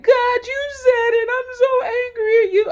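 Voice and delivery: high, loud crying wail at God; mock wailing cry. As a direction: wail it out like a hammed-up breakdown. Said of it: "I don't feel like I need to cry".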